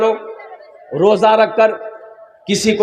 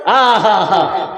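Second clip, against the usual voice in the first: relishing laugh; 5 semitones lower, much slower than usual. Real, not acted. Relishing the reward.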